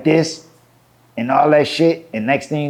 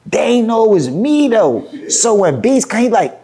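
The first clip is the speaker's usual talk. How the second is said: ordinary talk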